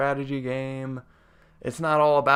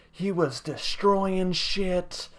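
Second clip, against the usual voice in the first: ordinary talk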